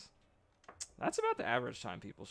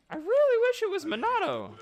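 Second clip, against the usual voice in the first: whiny high wish; 7 dB louder than usual. Real, not acted. Wishing aloud, high voice.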